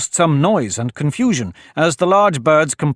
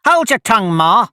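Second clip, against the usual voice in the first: high, louder, cheeky retort; snappish; 9 semitones higher, 5 dB louder than usual. A narrator's reading. Young crab answers her mother back, snappishly. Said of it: "a little snappishly".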